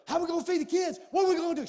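high, loud panicked wife voice; hammed-up wife freaking out over feeding kids; mock panic. Pastor imitating the panic his wife didn't have, high and loud. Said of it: "She didn't go".

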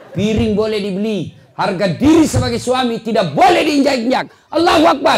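impassioned sermon rising to a shouted peak; fiery preaching. Real, not acted. Preacher rallying husbands to guard their dignity, building to a shout. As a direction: build to a loud, high rallying cry.

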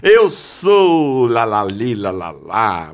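loud, drawn-out singsong boast; mock self-importance. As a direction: crow it grandly, stretching every vowel. Mocking someone full of themselves.

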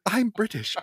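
high, over-the-top British protest; terrible British accent. Retold or imitated. Uptight fiance fending off a seductress, terrible British accent. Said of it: "terrible British accent".

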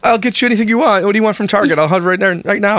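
high, gushing promises to a pet; high doting pet voice promising treats; doting to dog. Retold or imitated. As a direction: spoil the dog with words, voice up high. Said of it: "You're like".

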